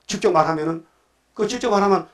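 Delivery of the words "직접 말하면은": normal talking voice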